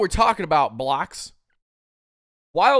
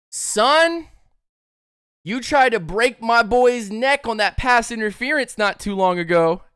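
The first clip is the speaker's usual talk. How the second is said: mock angry lineman; 7 semitones higher, 4 dB louder than usual. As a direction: play an enraged lineman promising payback. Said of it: "And Jake Matthews goes".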